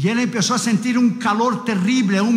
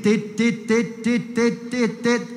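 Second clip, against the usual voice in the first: quick beeps that grow softer; softer and softer tit; fading monitor beeps; 4 dB quieter, much slower than usual. Retold or imitated. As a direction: let the monitor beeps die away. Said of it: "el monitor hizo tit".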